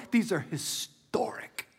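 slow, drawn-out whisper for emphasis; awed whisper. Real, not acted. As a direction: whisper it slowly as something momentous. Awed emphasis on momentous words.

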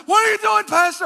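high, loud startled cry; alarmed shout. Retold or imitated. Driver to his panicking passenger, alarmed shout.

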